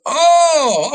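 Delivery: high, loud startled cry; startled yelp. Retold or imitated. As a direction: jolt as the paper hits his face. Officer startled by paper thrown.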